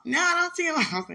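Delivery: put-on high, loud child's answer called back; son's reply. Son answering his mother from the bathroom, high and loud. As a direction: call back like a kid from another room.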